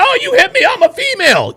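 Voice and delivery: high whiny mock victim; mocking woman's voice. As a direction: squeal it like a fake victim. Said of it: "all of a sudden".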